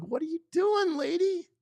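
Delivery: mock squeaky retort to his wife on the couch; silly high mock voice. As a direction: pitch it up like a goofy cartoon.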